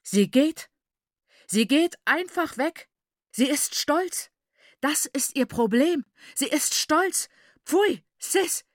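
high, loud incredulous outburst; outraged disbelief. A narrator's reading. Father watching his daughter leave, outraged disbelief. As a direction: spit out each phrase, scandalised.

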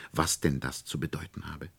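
ordinary delivery

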